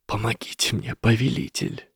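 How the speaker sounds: soft, hushed begging; kneeling, barely breathe the words; whispered plea. A narrator's reading. Lesath kneels before Harry, whispering a desperate plea. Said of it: "прошептал".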